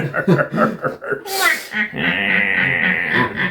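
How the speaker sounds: cackling witch laugh; witch cackle. Pretend. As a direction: throw out a wicked witch laugh. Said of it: "Tu sais vachement bien faire le rire de sorcière".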